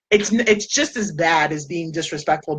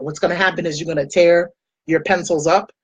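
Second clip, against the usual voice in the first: ordinary delivery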